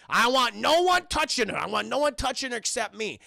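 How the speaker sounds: mock shouted command, high and loud; possessive order. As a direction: jump up loud, laying down the law. McCallum's jealous order, acted.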